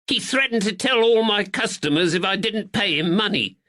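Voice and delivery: high, pleading northern shopkeeper; cornered man whining his innocence; frightened draper. Acted. Nervous draper pleads with the constable in a high voice.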